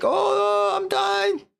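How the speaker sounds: very high wail of panic; hammed-up cry for his life; mock dying wail. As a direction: wail it in falsetto. Retold man sinking, fleeing.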